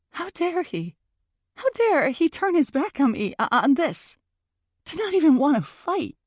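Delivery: high-pitched outraged disbelief; indignant. Acted. Girlfriend outraged he turns his back on her, indignant.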